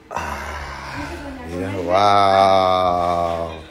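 low, long-drawn marvelling; awed wow. Real, not acted. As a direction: stretch the wow out slowly in a low voice. Se admira del pino encendido. (asombro lento y grave)

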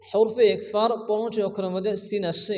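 ordinary talk, nothing marked

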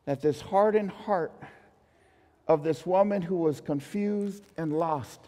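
usual speaking voice

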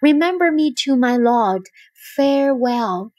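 usual speaking voice

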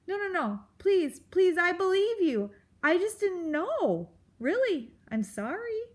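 high, hurried-sounding mouse apology; frightened pleading. A narrator's reading. Mouse threatened with quills, pleading apology.

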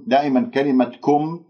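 normal talking voice

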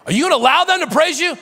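high, loud and fast indignant whine; mock the leaders' outrage; hammed-up scandalized question; outraged complaint.

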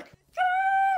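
high shout across the hall; calling out. Acted.

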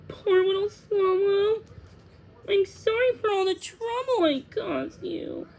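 high, tearful little voice; fighting back tears. A narrator's reading. Thumbelina apologises to the hurt swallow, fighting back tears. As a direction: apologise as if about to cry. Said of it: "fought back her tears".

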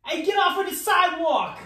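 put-on high-pitched New York accent; New Yorker accent. Player gives an accent clue, pushy New York accent. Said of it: "New Yorker".

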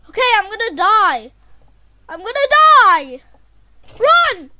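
loud, high cry of doom; panicked shouting. Real, not acted. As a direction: yell it as the danger closes in. Fleeing danger in game.

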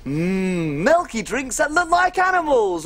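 usual speaking voice

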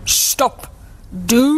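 long-held cow moo on the last word. A narrator's reading. Cow calling to the gingerbread man, drawn-out moo. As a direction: call out like a cow, ending in a moo. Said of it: "mooed the cow".

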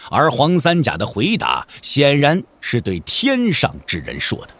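ordinary delivery

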